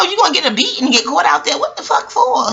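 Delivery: usual speaking voice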